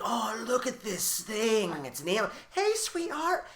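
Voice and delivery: put-on high, slow cooing baby talk; cooing at snake. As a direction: coo at it like a pet. Imitating the naive scientist.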